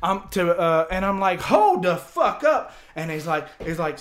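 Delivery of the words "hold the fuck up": much higher, loud and slow; stunned yell. Retold or imitated. Teller stopping the bounty talk with a slow loud yell. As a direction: halt them, slow and loud.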